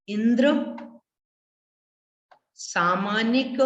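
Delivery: slow, word by word with long pauses; broken into parts. Shown as a demonstration. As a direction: recite it piece by piece, slowly. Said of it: "मैं तोड़ तोड़ करके पढ़ रहा हूं". (टुकड़े टुकड़े करके धीरे धीरे)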